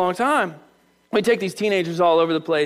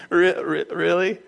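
sceptical; slower than usual. Acted. God doubting Elijah's protest, sceptical. God's sceptical reply, acted.

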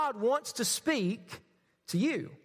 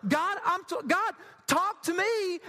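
high-pitched, comically imploring prayer; mock-desperate plea; 6 semitones higher, slower than usual. Young believer begging God to answer, hammed-up desperation. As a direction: beg high, stretching out the last words.

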